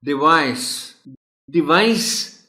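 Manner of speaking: "डिवाइस" said high and loud, with a lifted final; pronunciation drill. Shown as a demonstration. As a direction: repeat "डिवाइस" as a model. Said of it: "नहीं है ये लफ्ज".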